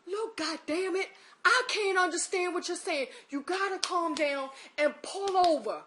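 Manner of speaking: retold frustrated order to calm down; exasperated cursing. Man telling crying driver to pull over, exasperated cursing. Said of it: "he kinda cursed at me".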